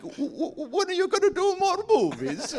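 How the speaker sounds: high, stammering desperate plea; hammed-up pleading in a high voice; mock desperate. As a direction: imitate a desperate man begging, voice shooting up. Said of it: "he had this desperate look".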